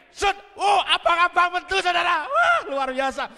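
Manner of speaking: loud, high burst of wonder; amazed exclamation. Retold or imitated. Preacher reliving red banknotes coming out, excited shout. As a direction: cry out in astonishment, drawing out the wow.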